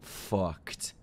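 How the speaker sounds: low, soft, halting words; ominous hush. Real, not acted. Viewer warning the villain he's doomed, low hush. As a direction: let it out quietly, word by word.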